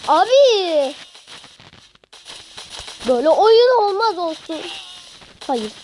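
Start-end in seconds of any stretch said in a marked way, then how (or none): 0.1-4.6 loud, high cry cursing the game; exasperated rant. Real, not acted. Gamer cursing the hard game, loud exasperated cry. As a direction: shout it in real frustration.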